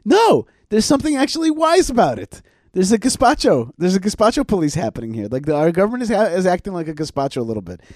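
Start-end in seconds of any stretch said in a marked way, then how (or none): 0.1-2.4 insistent inner voice. Retold or imitated. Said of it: "But I'm like".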